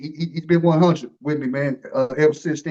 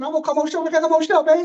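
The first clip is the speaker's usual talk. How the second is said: high, fast and pushy asking; eager coach voice; 10 semitones higher, 3 dB louder, much faster than usual. Retold or imitated. Host imitating the coach begging to come on the show, high and fast. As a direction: rattle it off like an impatient coach. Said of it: "Like they said".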